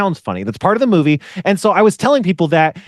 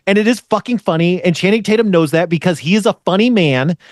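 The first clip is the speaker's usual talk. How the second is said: ordinary talk, nothing marked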